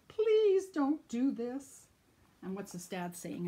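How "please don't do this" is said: slow, higher, louder pleading; begging mom. Acted. Mom begging son off the hose, slow pleading. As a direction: beg him slowly to stop. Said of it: "his mom's begging him".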